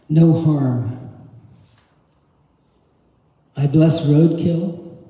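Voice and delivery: measured poetry recital with long pauses between lines; poem reading. Acted. Poet reads a blessing poem as an opening prayer, measured recital. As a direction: recite it as a solemn litany of blessings, phrase by phrase.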